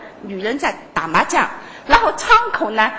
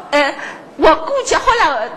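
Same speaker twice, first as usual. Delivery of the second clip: usual speaking voice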